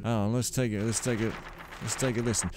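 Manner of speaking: ordinary delivery, nothing marked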